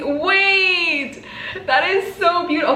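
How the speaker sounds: high-pitched, excited gushing; touched squeal. Real, not acted. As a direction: cry out in delighted surprise. Moved by One Piece sticker idea.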